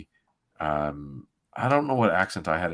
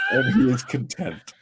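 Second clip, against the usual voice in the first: laughing; 9 semitones higher, 4 dB louder than usual. Real, not acted. Laughs finishing narration.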